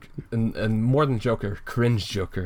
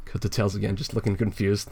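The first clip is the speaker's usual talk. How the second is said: usual speaking voice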